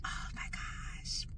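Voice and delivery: slow, voiceless whisper; overcome whisper. Real, not acted. Teacher alone after emotional goodbye, overcome whisper. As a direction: whisper it slowly, overwhelmed.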